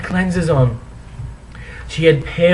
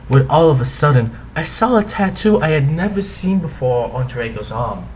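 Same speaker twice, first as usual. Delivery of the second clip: ordinary delivery, nothing marked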